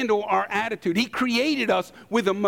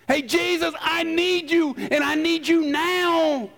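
urgent plea; 6 semitones higher than usual. Retold or imitated. Pleading prayer voiced by believer.

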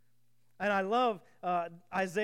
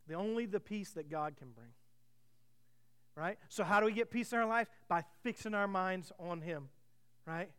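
normal talking voice

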